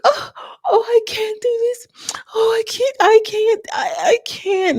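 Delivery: very high-pitched hammed-up breakdown; mock breakdown. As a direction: imitate someone falling apart mid-prayer, way up high. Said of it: "the sister breaks down".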